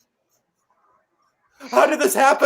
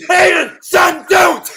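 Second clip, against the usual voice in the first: higher, louder shout of don't; desperate shout; 7 semitones higher, 6 dB louder than usual. Acted. Father shouting at his armed son to stop. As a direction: yell to stop him firing.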